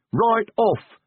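high, cheery troll reply; blithely cheerful, high-pitched agreement. A narrator's reading. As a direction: answer brightly, pitched right up. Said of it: "said Brick cheerfully".

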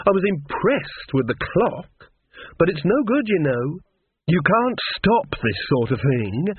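high, slow, lofty villainess; self-assured Lily. Acted. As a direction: lecture a rival with unhurried certainty. Villain Lily gloats to Granny.

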